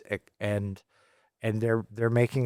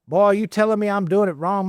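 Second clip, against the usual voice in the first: high, loud Southern farmer's voice, higher and louder; Southern accent; 11 semitones higher, 6 dB louder than usual. Retold or imitated. Poultry farmer snapping at the consultant, in a loud, high Southern accent. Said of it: "very Southern".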